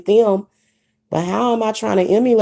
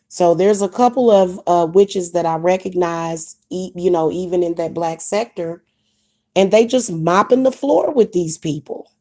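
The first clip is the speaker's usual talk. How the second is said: ordinary talk, nothing marked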